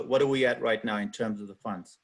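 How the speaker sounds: ordinary talk, nothing marked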